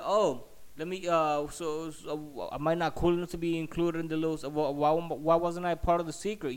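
re-enacted sarcastic confrontation; indignant sarcasm. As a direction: stammer into an aggrieved challenge. Acted sarcastic quarrel with the lady.

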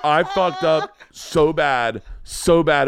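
high, loud, comic dismay; retold horrified realization. Husband realizing he offended his children's teachers, comic dismay. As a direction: groan it out in mock horror.

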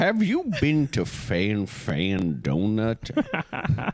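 put-on very slow, drawn-out old-timer; hammed-up old man drawling a question; folksy old man. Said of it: "he's kind of folksy".

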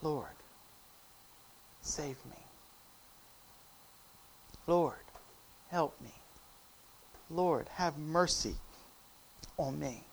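soft, low, halting prayer; subdued prayer for mercy; hushed plea. Acted. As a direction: plead quietly, pausing after each phrase. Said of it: "you need to cry out".

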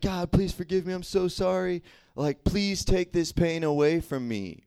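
high-pitched, whiny retold prayer for forgiveness; mock crying plea. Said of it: "crying, and I'm like".

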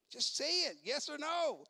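mock demand. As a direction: push them for a straight answer.

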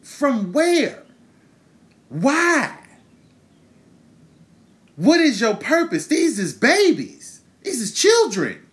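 plead, stunned and angry, why would you; half-acted outraged questions; exasperated demand. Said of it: "And I'm like".

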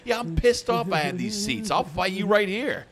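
high, loud fight-picking challenge; belligerent fan. Acted. Drunk fan challenging someone in the stands, belligerent.

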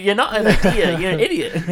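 loud, high, laughing mock reply; laughing retort. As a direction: blurt it out through laughter. Mocking retort while laughing.

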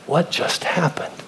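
breathless whisper of shock; stunned whisper. Acted. Survivors staring at the shore, a stunned whisper. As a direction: whisper it, dazed. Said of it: "They're like".

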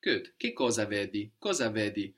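normal talking voice

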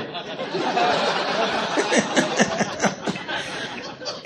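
a long, near-silent wheeze of laughter; breathless laugh. Real, not acted. After the cyclist's reply, helpless laughter. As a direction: laugh until there's no voice. Laughing at his own story.

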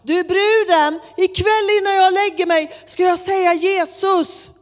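loud, high-pitched yell across a crowd; retold shout. Man shouting back to her across the metro, yelling. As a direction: shout it down the whole carriage. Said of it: "han skriker över hela tunnelbanan".

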